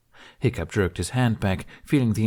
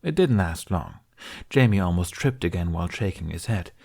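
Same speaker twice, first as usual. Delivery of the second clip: usual speaking voice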